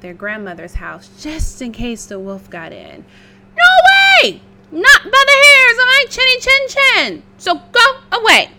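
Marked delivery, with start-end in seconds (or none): 3.6-8.5 very high, loud pig squeal; squealing little pig. Acted. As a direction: shriek it like a scared piglet. Pig refuses the wolf.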